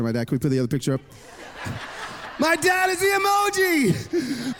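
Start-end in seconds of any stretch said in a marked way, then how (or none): 2.4-4.6 high, drawn-out gleeful squeal; excited reveal. Real, not acted. Preacher reveals dad's emoji picture to the crowd, gleefully excited.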